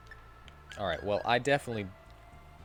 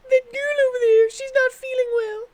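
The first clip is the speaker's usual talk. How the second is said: put-on high girlish voice, a bit louder; high concerned girl; girl NPC voice; 10 dB louder than usual. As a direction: chirp it like a worried village girl.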